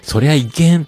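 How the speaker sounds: そりゃいけん said high and loud in Yamaguchi dialect. Shown as a demonstration. 山口弁で、高く強めに「そりゃいけん」. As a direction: toss off a Yamaguchi no-way. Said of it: "これ山口弁".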